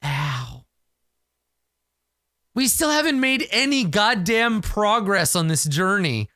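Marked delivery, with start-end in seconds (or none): 2.5-6.3 exasperated complaint, pitched up; frustrated groan. Real, not acted. Player fed up with failed rolls, exasperated complaint. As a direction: grumble at the dice, fed up.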